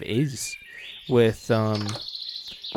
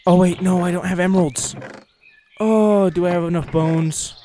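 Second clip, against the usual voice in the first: higher, louder burst of dismay; dismayed alarm; 5 semitones higher, 4 dB louder than usual. Real, not acted. Player realizes materials are missing, alarmed outburst. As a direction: cry out at a sudden snag.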